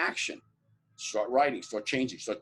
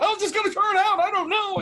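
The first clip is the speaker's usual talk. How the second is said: worried warrior; 6 dB louder than usual. Pretend. Imagined warrior worrying mid-battle.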